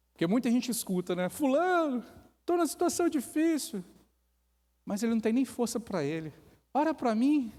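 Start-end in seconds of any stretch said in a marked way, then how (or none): 1.3-3.8 high-pitched mock plea for help; raised, needy put-on voice; pleading. Acted plea for prayer. 6.7-7.5 high, loud mock request for prayer; imitated needy appeal; pleading. Acted plea for prayer.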